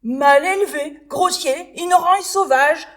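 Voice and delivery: high, loud furious insults; angry shout. Acted. Butterfly shouting the same insults again, high and loud. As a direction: spit out each insult.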